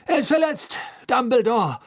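high, agitated shouting; loud announcement. A narrator's reading. Fudge appealing to Dumbledore about Harry, loud shouting. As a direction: shout it out in alarm. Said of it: "verkündete Fudge laut".

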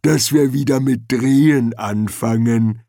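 slow, creaky troll voice; ponderous troll, words dragged out; creaking troll. A narrator's reading. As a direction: creak it out slowly like a lumbering troll. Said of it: "knarzte er".

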